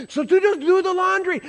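high-pitched, exasperated snap back; defensive. Retold or imitated. Husband telling his wife to do laundry, defensive. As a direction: throw it back at her, voice up and loud. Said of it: "so I'm getting a little defensive".

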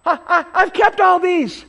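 high, stammering proud claim; excited rich man; excited boast. Retold or imitated. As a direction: voice the rich man bursting with pride. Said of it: "he's excited".

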